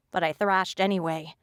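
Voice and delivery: usual speaking voice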